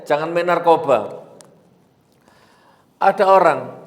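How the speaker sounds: ordinary talk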